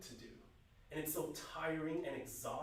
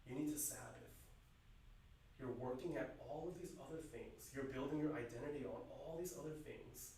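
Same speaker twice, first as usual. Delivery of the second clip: tender voice of God; 3 semitones lower, 10 dB quieter than usual. Retold or imitated. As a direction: gently tell them they need rest. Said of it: "God says to us".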